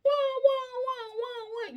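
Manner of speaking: high siren wail, fading out; ambulance wail. Retold or imitated. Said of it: "like an ambulance".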